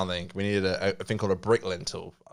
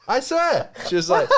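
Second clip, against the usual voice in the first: laughing hard, higher and louder; 5 dB louder than usual. Real, not acted. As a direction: crack up while telling it. Laughing while retelling compliment.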